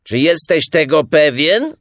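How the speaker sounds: high, loud, astonished question; amazed. A narrator's reading. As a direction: call out in surprised doubt. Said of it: "jeszcze bardziej zdziwiony".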